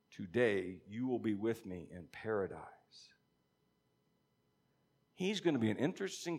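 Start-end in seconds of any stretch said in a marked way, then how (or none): 0.1-3.1 soft unvoiced whisper, slow and tender; whispered promise. Jesus whispering his promise to the criminal on the cross. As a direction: breathe it like a promise to a dying man.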